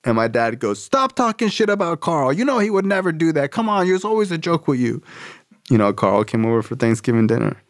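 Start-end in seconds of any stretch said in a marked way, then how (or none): 0.8-5.0 high-pitched fed-up dad rant; indignant scolding. Retold or imitated. Dad defending Carl to son, exasperated.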